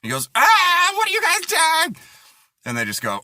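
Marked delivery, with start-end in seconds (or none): 0.3-2.0 loud, high mock scream; shocked little boy. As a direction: shriek like a horrified kid. Child walking in on brother.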